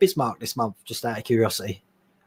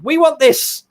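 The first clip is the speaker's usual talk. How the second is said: mock crowd shout; 9 semitones higher, 4 dB louder, slower than usual. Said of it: "you could all shout at D'Agostini".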